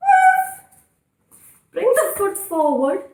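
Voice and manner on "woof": high, loud playful barking; cheerful mock dog bark; happy dog woof. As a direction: bark like an excited puppy for the kids. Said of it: "and say woof".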